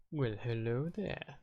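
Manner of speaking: drawn-out teasing hello; mock sneaky greeting. Surprising the viewers from hiding, slow soft mock greeting. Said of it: "I'm kidding, I'll stop hiding now".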